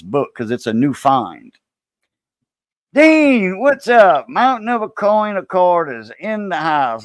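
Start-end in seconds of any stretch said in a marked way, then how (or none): 2.9-7.0 high, loud called-out greeting; excited welcome. Real, not acted. Host greeting a friend joining the stream, excited shout-out. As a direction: hail a friend who just walked in, like an announcer. Calls out greeting to arriving viewer.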